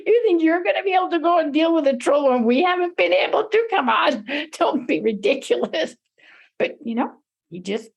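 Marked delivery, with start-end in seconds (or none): 0.0-6.0 high, jeering through laughs; mocking laughter. A narrator's reading. Brothers mock the youngest for going, laughing at him. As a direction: tease with scoffing laughter. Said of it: "they just started laughing".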